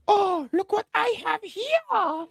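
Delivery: high, slow smug voice; mock Rey taunt. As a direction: taunt sweetly from above. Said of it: "she's like".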